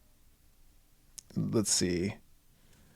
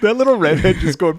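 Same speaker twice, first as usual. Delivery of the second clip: ordinary talk, nothing marked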